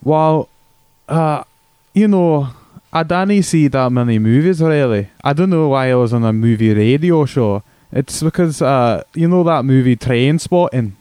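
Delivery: hammed-up Scottish brogue; broad comic Scottish accent. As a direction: play a crude Scotsman caller on the radio. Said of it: "crude impression of a Scotsman".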